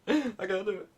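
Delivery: high, laughing give-up; laughing despair. Real, not acted. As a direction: laugh it off in defeat.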